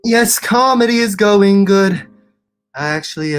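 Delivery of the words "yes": ordinary delivery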